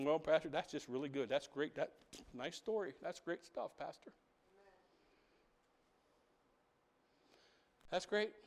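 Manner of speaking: mildly mock the doubting congregation; imagined listener. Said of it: "You're sitting there thinking".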